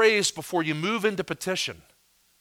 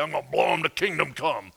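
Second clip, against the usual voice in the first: lightly voiced movie gunman's threat; a hint of an action hero threatening at gunpoint; action-movie tough guy; 3 dB quieter than usual. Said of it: "action movie and somebody's pointing a gun".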